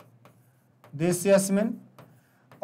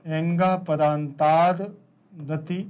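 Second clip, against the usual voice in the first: slow recital; 2 semitones lower, much slower than usual. Shown as a demonstration. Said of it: "slow recital of the rule".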